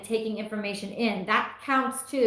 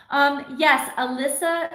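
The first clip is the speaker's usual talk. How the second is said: ordinary talk, nothing marked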